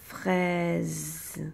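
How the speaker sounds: "Fraise" stretched, long f, vowel and final z; sound-by-sound word. Shown as a demonstration. Teacher giving pupils a word to split, elongated "Fraise". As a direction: draw out every sound of "Fraise". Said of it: "chaque son que tu entends".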